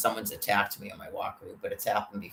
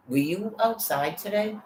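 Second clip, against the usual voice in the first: a prying housemate, pitched higher; 8 semitones higher than usual. Retold or imitated. Roommate quizzing the man who came home, suspicious. Imagining housemate questioning attacker.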